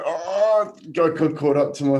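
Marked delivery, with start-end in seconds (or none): 0.0-0.8 high, loud imitated cry of pain; hammed-up howl at a stubbed toe; retold pain howl. Runner acting out the broken toe, loud mock howl.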